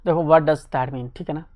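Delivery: ordinary talk, nothing marked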